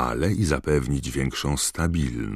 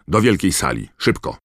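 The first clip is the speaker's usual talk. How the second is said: louder, higher brisk command; sharp order; 5 semitones higher, 6 dB louder than usual. A narrator's reading. Professor sends pupils to the hall, ordering sharply. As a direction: hurry them along sharply. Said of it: "powiedziała ostro".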